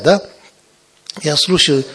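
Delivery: ordinary talk, nothing marked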